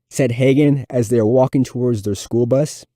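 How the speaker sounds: ordinary delivery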